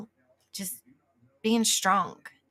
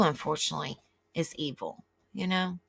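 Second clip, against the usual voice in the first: usual speaking voice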